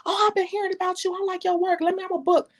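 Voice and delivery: a hint of an excited cousin who just heard about you; gushing new clients. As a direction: play an eager fan asking to book, voice lifted up high. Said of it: "and they were like".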